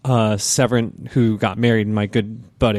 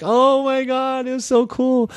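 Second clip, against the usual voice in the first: much higher, slow gushing delight; gushing awe; 12 semitones higher, slower than usual. Retold or imitated. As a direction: gush like a starstruck fan. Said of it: "We're like".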